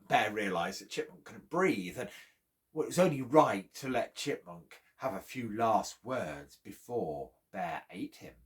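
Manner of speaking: ordinary delivery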